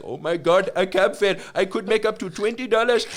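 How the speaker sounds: hammed-up Indian accent, rising in excitement; desperate Indian accent. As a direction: imitate an eager, desperate cabbie with an Indian accent. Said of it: "a very desperate Indian man was just like".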